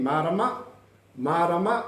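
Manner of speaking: "Marama" lifting at the end; rising question intonation. Shown as a demonstration. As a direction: ask "Marama" as a question, voice going up. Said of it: "Can you hear the intonation in your voice?".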